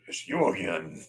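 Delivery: low, lightly imitated conductor; mock-stern recognition of a regular; stern ticket inspector. Ticket lady spots her regular passenger, mock stern. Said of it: "stern look".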